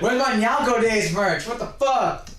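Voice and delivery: high, hammed-up indignant yell; mock outrage. Mock demand for missing merch.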